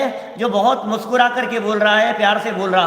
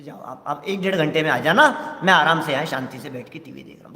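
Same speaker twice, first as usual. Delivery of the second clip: soft, quick and honeyed, a lightly voiced guest; sweet-talking guest; 7 semitones lower, 4 dB quieter, faster than usual. Guest sweetly sends host off to sermon, softly and quickly. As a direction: coax the host gently out the door. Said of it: "बहुत प्यार से बोल रहा है।".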